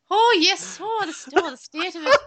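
high, loud hammed-up Irish lilt; over-the-top fake Irish accent; crap Irish accent. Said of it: "put on our crap Irish accents".

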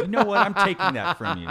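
loud, high, laughing taunt; mock outburst. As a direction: shout it out gleefully.

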